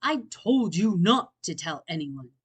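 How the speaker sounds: glare through the words; angry Bear voice. Acted. Bear angry at Franklin, glaring. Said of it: "Bear glared".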